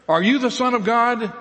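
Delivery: high, loud challenge; the council interrogating Jesus; demanding. Acted. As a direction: demand an answer at once. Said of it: "asked him almost in unison".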